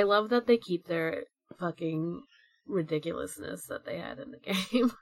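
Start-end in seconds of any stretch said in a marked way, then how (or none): none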